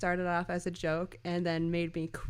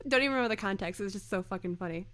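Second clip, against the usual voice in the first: ordinary talk, nothing marked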